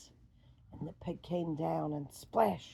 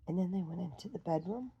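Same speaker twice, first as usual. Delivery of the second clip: ordinary delivery, nothing marked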